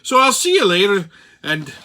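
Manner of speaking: whimsical Irish lilt; Irish accent. Pretend. Host ranting to listeners as an Irishman, whimsical Irish accent. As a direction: put on a playful Irish brogue. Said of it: "enough of the Irish accent for now".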